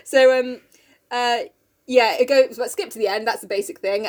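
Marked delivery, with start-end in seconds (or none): none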